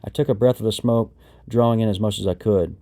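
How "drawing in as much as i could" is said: plain reading voice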